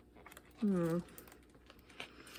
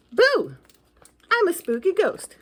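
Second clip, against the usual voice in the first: loud playful ghost boo; hammed-up ghost scare; mock scary boo; 6 semitones higher, 5 dB louder than usual. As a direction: jump out and shout boo.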